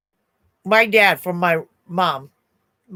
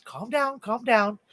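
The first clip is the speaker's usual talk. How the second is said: soothing; 3 semitones higher, 7 dB quieter, slower than usual. Retold or imitated. Customer soothing her panic.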